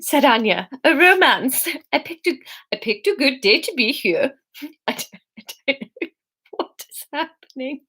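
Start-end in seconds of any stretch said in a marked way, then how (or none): 0.8-4.3 stumbling, higher faux-Swedish accent; messy Swedish accent. Narrator retries Anya's welcome, messy Swedish accent. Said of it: "Anya's accent is". 4.6-7.8 higher, softer, breaking up laughing; suppressed giggles. Real, not acted. Narrator baffled by own accent, giggling.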